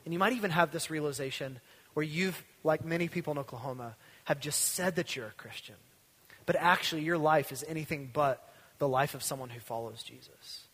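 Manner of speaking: ordinary talk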